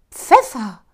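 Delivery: loud indignant cry; loud cry after sneezing; shout. Acted. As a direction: blurt it out loud. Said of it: "rief er".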